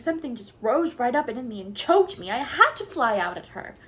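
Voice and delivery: high, loud, rushing protest; upset outburst. Acted. Anne defending herself to Marilla, high upset outburst. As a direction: pour it out, voice climbing high.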